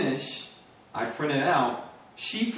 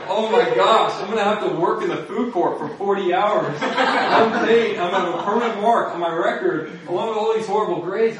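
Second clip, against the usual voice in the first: panicked self; 8 semitones higher, 8 dB louder than usual. Retold or imitated. Panicking about honor court.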